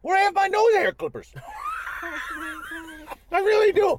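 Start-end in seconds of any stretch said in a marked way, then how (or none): 1.4-3.3 high laugh. Real, not acted. Laughing at nose clippers.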